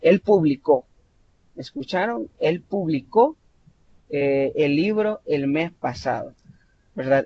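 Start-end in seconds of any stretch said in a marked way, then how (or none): 0.0-0.9 "publicó" stressed on the final CÓ; pu-bli-CÓ, past-tense verb; stress on last syllable. Shown as a demonstration. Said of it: "sílaba tónica en la última vocal". 2.4-3.4 "publicó" with the beat on CÓ; pu-bli-CÓ, end-stressed; stress on last syllable. Shown as a demonstration. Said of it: "sílaba tónica en la última vocal".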